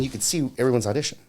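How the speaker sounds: ordinary delivery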